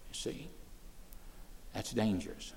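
ordinary talk, nothing marked

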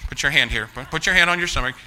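usual speaking voice

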